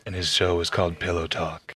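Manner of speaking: late-night DJ voice. Retold or imitated. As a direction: purr it like a smooth late-night DJ. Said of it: "he'd talk like this".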